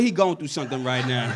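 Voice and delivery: pitying murmur. Retold or imitated. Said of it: "People like".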